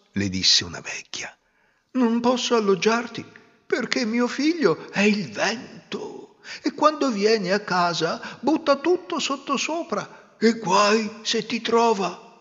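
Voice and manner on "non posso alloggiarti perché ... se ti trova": lightly voiced, higher old woman; pitying old woman. Old mother of the wind pitying the girl, lightly voiced. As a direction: sigh with pity for the girl.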